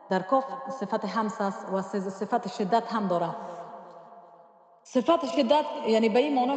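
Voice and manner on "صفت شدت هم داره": ordinary talk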